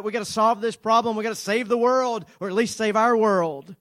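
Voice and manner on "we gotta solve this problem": usual speaking voice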